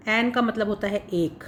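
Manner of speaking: ordinary delivery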